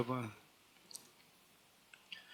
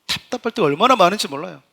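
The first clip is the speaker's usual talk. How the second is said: ordinary talk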